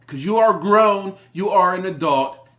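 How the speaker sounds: loud, pushing it high; scolding shout. Real, not acted. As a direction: tell them off hard. Scolding the audience.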